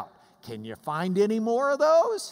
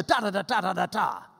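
drummed TA-DA-DA syllables; rat-a-tat rhythm. Shown as a demonstration. Teacher mimics the clause rhythm, rat-a-tat. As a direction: tap out the beat with the voice. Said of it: "rat-a-tat formula".

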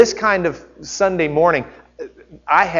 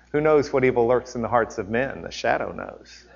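The Shadow voice; 5 semitones lower, 6 dB quieter than usual. Pretend. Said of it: "there'll be a voice in the darkness".

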